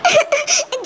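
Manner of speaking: high, broken sob. Acted. Wife alone at night, sobbing. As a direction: let a sob escape.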